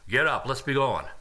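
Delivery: brusque order, ironically billed as gentle; mock kindly. Master to his fallen concubine at the door, brusque. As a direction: bluntly rouse her with no tenderness. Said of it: "very kindly and gently said to her".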